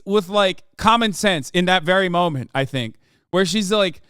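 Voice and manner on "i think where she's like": normal talking voice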